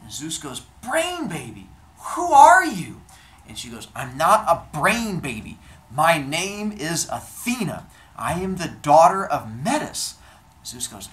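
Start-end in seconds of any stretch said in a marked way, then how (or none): 0.8-3.0 high, loud, stretched-out call; excited doting question. Acted. Zeus calls to his newborn. 4.0-10.1 louder, raised, stately announcement; proud declaration. Acted. Athena declares who she is.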